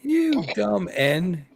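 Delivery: high, slow, drawn-out scornful put-on voice; a put-on Philly guy mocking his dumb friend; mocking street guy. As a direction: stretch it out like a streetwise Philly guy setting his buddy straight. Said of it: "the other guy looks at you and goes".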